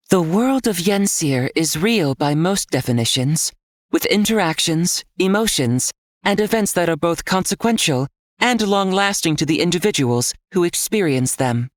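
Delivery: an all-knowing guide speaking inside his head; calm system voice. A narrator's reading. System voice answers Nick.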